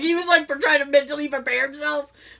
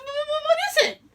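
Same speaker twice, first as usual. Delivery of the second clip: much higher, stammering mock panic; faster than usual. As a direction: stutter it, half-laughing alarm.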